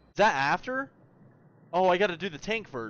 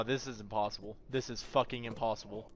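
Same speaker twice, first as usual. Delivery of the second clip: usual speaking voice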